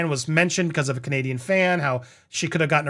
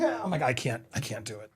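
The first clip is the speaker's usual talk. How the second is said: exasperated mutter; 5 semitones lower, 9 dB quieter than usual. Real, not acted. Exasperated aside.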